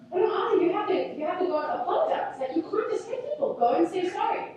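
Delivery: high-pitched pleading parent voice; imitated exasperated mommy voice; mom coaxing child. Mother coaxing child to apologize, retold.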